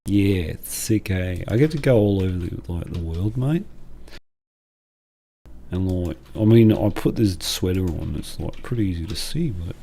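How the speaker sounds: a hint of Australian accent. Waldo chats to a visitor in a light Australian accent. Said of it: "I didn't know Waldo was Australian".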